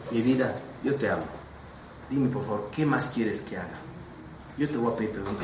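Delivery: tender plea. Retold or imitated. Pleading tenderly with brother.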